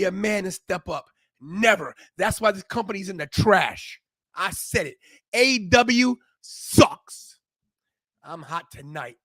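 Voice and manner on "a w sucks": drawn out, each word hammered; slow emphatic jab. Real, not acted. Host trashing the rival company, slow and emphatic. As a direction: spit it out slowly and firmly.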